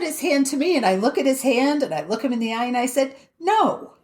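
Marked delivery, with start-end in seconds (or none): 3.4-3.9 sharp higher no, cutting him off; flat refusal. Retold or imitated. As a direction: refuse the handshake point-blank. Refusing the salesman's handshake.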